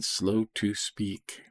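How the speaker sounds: stretched out very slowly, word by word; acting out the meaning by speaking slowly. Shown as a demonstration. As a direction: drag it out to show slowness. Teacher drawing out the verse.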